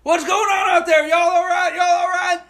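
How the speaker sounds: high, loud Southern neighbor calling from the porch. Retold or imitated. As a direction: holler it across the yard like a porch-sitting local. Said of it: "somebody comes out on the porch".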